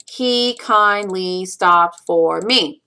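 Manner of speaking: "He kindly stopped for me" slow, syllable by syllable; counting out syllables. Shown as a demonstration. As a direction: give each syllable of "He kindly stopped for me" its own even beat. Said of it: "count out the syllables".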